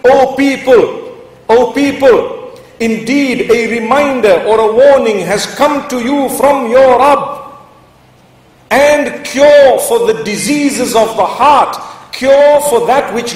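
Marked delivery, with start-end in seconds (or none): none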